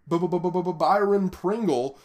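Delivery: real stumble over the name, forcing it out; stuttering. Real, not acted. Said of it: "I'm not sure how that was so hard to".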